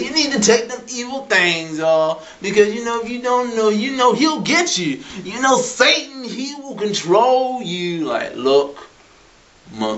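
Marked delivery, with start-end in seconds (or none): 0.0-8.0 lightly voiced, higher-pitched worried mother; mother's warning. Mother warning her child about the devil, mildly mocked warning.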